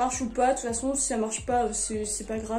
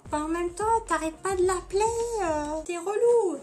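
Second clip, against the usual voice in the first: higher put-on annoyed voice; mock exasperation, raised in pitch; 5 semitones higher than usual. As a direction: complain in a higher character voice. Acting [name]'s annoyed reply.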